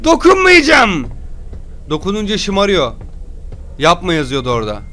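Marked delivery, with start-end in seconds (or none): 0.0-1.1 put-on drawn-out loud refusal to the baby; slow and loud, I won't touch you. Baby game, loud mock refusal. (yavaş ve yüksek sesle reddediş)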